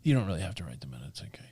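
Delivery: soft unvoiced reassurance; hushed it's okay; whispered aside. Real, not acted. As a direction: whisper it kindly.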